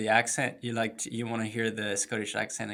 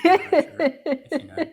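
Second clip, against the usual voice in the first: much higher, louder, through laughter; laughing; 12 dB louder than usual. Real, not acted. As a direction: tease with a laugh. Teasing, obviously they know each other.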